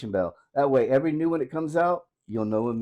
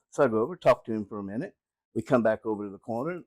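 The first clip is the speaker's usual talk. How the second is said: normal talking voice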